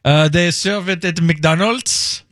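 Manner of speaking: slow mock French accent; bad French accent. Host quoting a Frenchman on the beer, bad French accent. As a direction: drag it out in a put-on French accent. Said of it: "this bad French accent".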